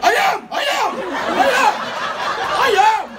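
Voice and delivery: very high, loud squeal; panicked shouting. Retold or imitated. Answering a partner screaming in his face, panicked high shout. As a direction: yell back in frantic, repeated bursts.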